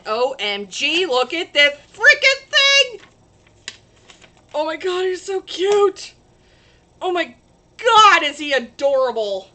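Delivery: loud, high, slow squeals of delight; excited gushing. Real, not acted. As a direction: gush over how cute it is. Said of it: "sorry, I get excited about things".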